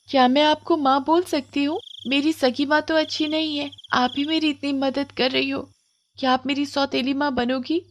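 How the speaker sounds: put-on high, soft child's voice; pleading little girl. Komal asks the witch to be her stepmother, softly pleading.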